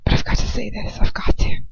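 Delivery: strained, breathless whisper; weak whisper. A narrator's reading. Girl forcing a confession to Lucius in a weak whisper. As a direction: fight to get the words out. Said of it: "My voice comes out like a, as a thread".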